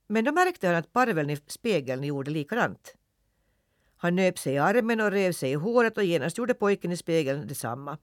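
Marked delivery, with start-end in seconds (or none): none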